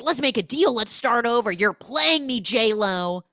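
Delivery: loud, high, hammed-up accusing; mock angry confrontation. Jealous neighbour accusing her of playing him, mock outrage. Acted quarrel in retold scene.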